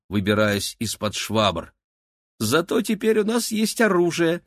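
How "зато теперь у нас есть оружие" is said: put-on much higher, gleeful girl's voice; giggly. A narrator's reading. Girl arming herself with a broom beside her brother, giggly. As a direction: pipe it up high with mischief. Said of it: "хихикнула Кира".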